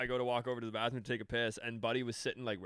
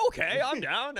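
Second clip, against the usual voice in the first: high, dopey mock okay; dumb agreement; 11 semitones higher, 4 dB louder than usual. Narrator mocking his own dumb face agreeing, high and dopey. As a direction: sound like a clueless drunk saying yes. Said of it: "the dumb fucking look on my face".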